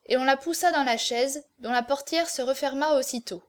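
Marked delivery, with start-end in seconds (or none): none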